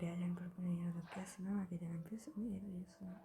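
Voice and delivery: softly, keeping the volume down; hushed voice. Real, not acted. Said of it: "నేను కొంచెం మెల్లగానే మాట్లాడతాను".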